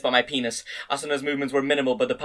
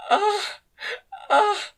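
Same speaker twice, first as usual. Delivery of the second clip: high moans. A narrator's reading. Woman moans in love scene.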